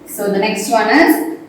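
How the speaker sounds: usual speaking voice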